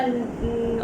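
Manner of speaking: normal talking voice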